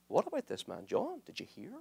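hushed rumor between brothers. Retold or imitated. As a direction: whisper it like church gossip. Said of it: "in hushed voices".

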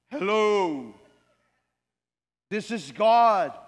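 loud, slow, booming voice of God; a lightly voiced God answering the man; voice from heaven. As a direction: announce yourself from heaven. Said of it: "there was a sound from heaven".

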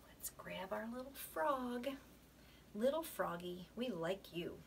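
hushed, secretive whisper. Real, not acted. Teacher whispers to class.

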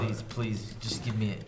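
low, soft begging; hushed pleading. Real, not acted. Participant begging for a point on the wheel, low quiet plea. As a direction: beg under your breath.

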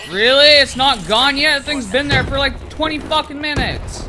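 real gamer frustration; raging. Real, not acted. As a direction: fire off an angry, disbelieving complaint. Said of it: "I was raging a little bit there".

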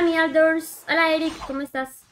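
normal talking voice

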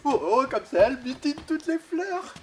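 ordinary delivery

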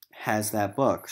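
ordinary talk, nothing marked

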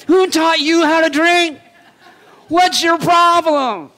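high, loud, hammed-up scolding with a laugh; mock angry Gideon. Gideon scolding men who drank wrong, mock yell. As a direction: yell at the men like a fed-up drill sergeant. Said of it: "I can just imagine Gideon going around kicking people".

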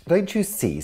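high, urgent "don't you see"; eager exclamation. Acted. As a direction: make him get it. Sue eager to convince Spot.

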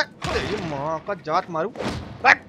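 ordinary talk, nothing marked

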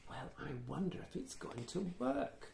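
soft wondering aside; hushed aside. A narrator's reading. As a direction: wonder aloud quietly.

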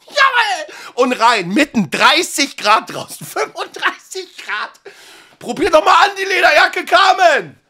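high, loud, giggling salesman voice; laughing pushy seller. Acted. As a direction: push the jacket on her, amused.

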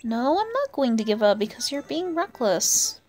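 ordinary talk

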